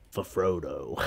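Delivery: low, hushed meme voice; deadpan movie-hero quote; mock solemn line.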